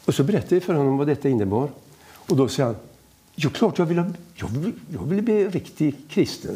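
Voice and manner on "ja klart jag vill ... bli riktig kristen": lightly voiced eager old man, higher-pitched. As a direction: stumble into an eager yes, mildly imitated. Old man eagerly accepts the offer.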